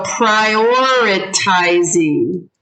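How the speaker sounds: PRIORITIZING drawn out slowly; sounding it out. Shown as a demonstration. Teacher introduces a vocabulary word, "prioritizing" slowly sounded out. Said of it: "and sound it out".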